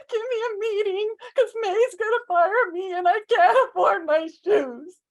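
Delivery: high, loud blubbering plea; hammed-up ugly cry; mock ugly crying. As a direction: wail it through sobs. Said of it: "I start ugly crying".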